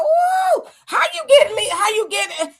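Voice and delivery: high shouted ooh, how you get; outraged shout. Real, not acted. Host ranting to viewers about reparations, shouting. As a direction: burst out in furious disbelief. Host erupting mid-rant.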